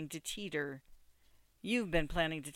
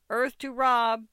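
loud, raised attention-getting call; 7 semitones higher, 11 dB louder, slower than usual. A narrator's reading. Bix hails a distracted Rob, calling out. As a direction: hail someone who has drifted off.

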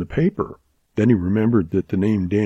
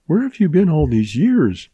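eager question; 6 semitones higher, 4 dB louder than usual. A narrator's reading. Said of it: "she asked quickly".

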